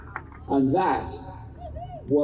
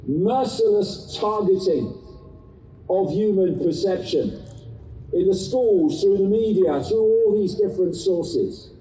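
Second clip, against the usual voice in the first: urgent activist; 4 semitones higher than usual. Acted. Impassioned speech to crowd.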